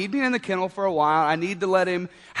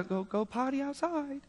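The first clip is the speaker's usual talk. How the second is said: half-hearted coaxing; 3 semitones higher, 12 dB quieter than usual. Retold or imitated. Tired owner still prompting puppy, half-hearted and soft. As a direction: murmur the phrase with little hope. Weary retry of potty phrase.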